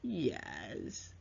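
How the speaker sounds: soft, low, drawn-out falling yes; sinking, disbelieving agreement; sarcastic. Shown as a demonstration. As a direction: say yes but don't believe it. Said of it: "you're not believing it".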